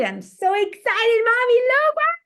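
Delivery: put-on high, loud thrilled little boy; excited child. Boy showing his mom his caterpillar, high and thrilled. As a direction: squeal it like a kid with a new pet. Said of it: "He's so excited".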